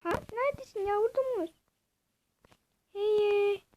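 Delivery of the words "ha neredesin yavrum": normal talking voice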